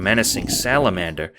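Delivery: ordinary delivery, nothing marked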